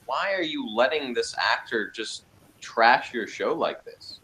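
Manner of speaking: normal talking voice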